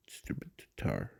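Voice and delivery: whispered under breath while searching; hushed annoyed whisper. Real, not acted. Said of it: "let me find it".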